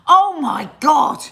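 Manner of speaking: loud, high, drawn-out outburst; slow, shouted surprise; startled exclamation. Real, not acted. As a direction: cry out in sudden astonishment. Sudden exclamation spotting something.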